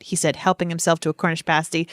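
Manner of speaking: normal talking voice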